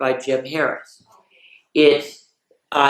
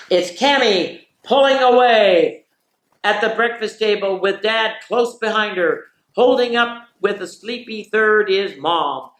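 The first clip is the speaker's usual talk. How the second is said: raised, excited play-by-play call; race announcer; 6 semitones higher than usual. Acted. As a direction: call the start like a horse race. Mock horse-race call of family morning.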